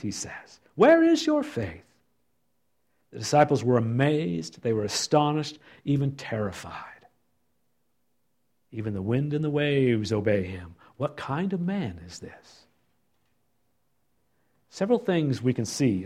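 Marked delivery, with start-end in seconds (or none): 0.7-1.8 higher, questioning rebuke; high reproach. Acted. Jesus voiced reproaching. 8.7-12.7 soft awe. Acted. Disciples voiced in awe.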